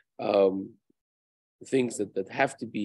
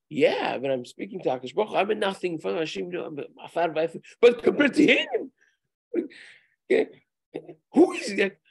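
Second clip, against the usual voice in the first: rising scornful disbelief, pitched up at the end; haughty old man; 7 semitones higher, 4 dB louder than usual. Retold or imitated. A rabbi mimicking the arrogant old man who looks down on the other worshipper. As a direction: sneer at the idea of being equal to him.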